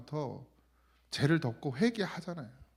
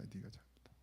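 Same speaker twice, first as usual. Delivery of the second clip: whispered rebuke; 6 semitones lower, 22 dB quieter, faster than usual. Acted. Pastor voices God's quiet rebuke to himself, whispering. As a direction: repeat 네가 잘못한 거야 as a hushed, unvoiced whisper.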